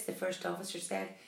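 usual speaking voice

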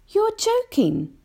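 put-on very high, slow incredulous boy; James. A narrator's reading. James doubts the grasshopper, high incredulous boy. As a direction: squeak it slowly in disbelief. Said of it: "James said".

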